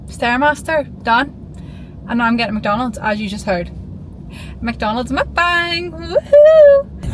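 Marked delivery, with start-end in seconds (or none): 4.6-7.1 high, loud squealing woohoo; excited cheer. Real, not acted. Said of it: "I'm so excited".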